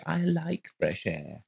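high, slow, strange woman's voice; odd woman voice. Acted. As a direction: play her vague and a little off. Mrs Colgate dreamy.

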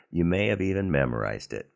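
usual speaking voice

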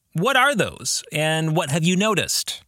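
usual speaking voice

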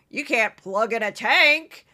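loud, slow, blustering TV pundit voice; Joe Concha impression. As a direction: play a generic Fox pundit scoffing at tanks. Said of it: "That's my Joe Concha".

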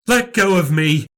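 high, sharp demand; indignant. Acted. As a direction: push him off with words. Nan grabbed.

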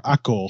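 Accor with a throaty French R at the end; French glottal R. Shown as a demonstration. Co-host showing the French R in Accor. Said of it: "it's a glottal R".

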